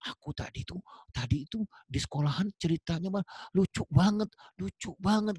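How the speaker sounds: put-on high, eager kid voice telling a funny story; excited child. Child tells father about school, high and excited. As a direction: bubble over like a child back from school. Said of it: "anaknya datang ke ayahnya".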